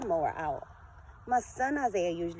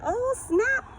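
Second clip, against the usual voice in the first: much higher, louder hyped "Oh, snap"; excited oh snap; 7 dB louder than usual. Retold or imitated. Daughter hyping up her dressed-up mom, excited high exclamation. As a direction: hype her up like an impressed teen. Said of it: "my daughter be like".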